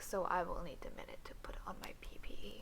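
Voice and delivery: whisper. Acted. Quick whispered aside.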